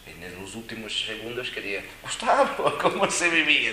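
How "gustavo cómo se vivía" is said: voz mucho más aguda y fuerte, riendo; abuelo preguntando. Retold or imitated. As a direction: imita al abuelo con una risa. Abuelo moribundo pregunta, entre risas. (el abuelo de noventa y tres años pregunta a Gustavo, agudo y riendo)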